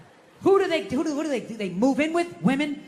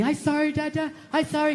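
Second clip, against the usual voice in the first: imitated small daughter; 2 semitones higher than usual. Daughter apologizing to her dad, small imitated child voice. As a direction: mimic a little kid saying sorry. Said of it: "one more time it's gonna be".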